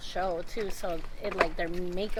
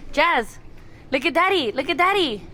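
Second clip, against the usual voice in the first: calling from far away; 9 semitones higher, 6 dB louder than usual. Real, not acted. Father calling baby to look.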